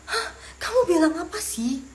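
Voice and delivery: high-pitched baffled "huh?"; puzzled exclamation. Acted. Learner reacts to a friend's fast talk, puzzled exclamation. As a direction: play someone who caught none of it.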